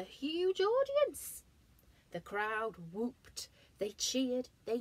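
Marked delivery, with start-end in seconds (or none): 0.0-1.4 dramatic reveal. A narrator's reading.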